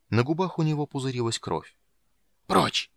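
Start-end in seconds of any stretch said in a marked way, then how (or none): 2.4-2.9 high, sudden cry to get out; shouted order. A narrator's reading. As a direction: shout her away with failing strength. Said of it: "крикнул он". (выкрикнуть, прогоняя)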